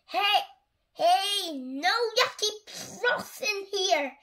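high, drawn-out scolding yell; loud storybook cook's protest. A narrator's reading. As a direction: shoo them out as an outraged cook. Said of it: "yelled the cook".

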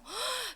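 sharp audible intake of breath; storyteller's gasp. Pretend. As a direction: gasp for the children. Reacting for the children to the story. (헉 하고 숨 들이쉬기)

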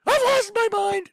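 high, loud, hammed-up crazy voice; mock crazed self. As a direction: play yourself as a man who just snapped, up high. Mocking himself as crazed.

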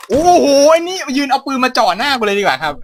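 startled loud outburst; loud gamer yell. Real, not acted. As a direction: yell it out at the enemy with the gun. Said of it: "เสียงดังมากเลยครับ".

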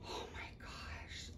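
breathy hushed whisper; awed whisper. Real, not acted. Vlogger realising it is a boy, whispering. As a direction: barely voice it, overwhelmed.

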